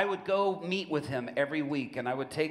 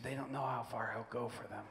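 quiet confession; 8 dB quieter than usual. Retold or imitated. Prisoner confides quietly.

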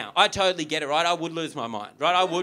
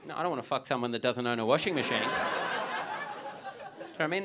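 usual speaking voice

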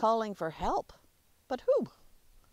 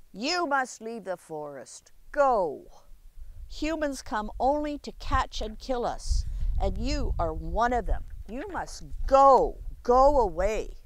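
owl ordering; 6 dB louder than usual. Acted. The owl orders the human child out of the forest, stern and loud. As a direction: drive the boy off, louder each time. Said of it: "The owl hooted in reply".

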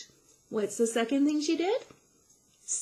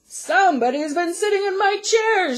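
loud, booming great big bear; Papa Bear voice; 9 semitones higher, 9 dB louder than usual. A narrator's reading. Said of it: "said Great Big Papa Bear".

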